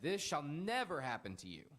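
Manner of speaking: loud, high protest with force on never; rebuking shout. A narrator's reading. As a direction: cry it out in rebuke. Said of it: "began to rebuke him".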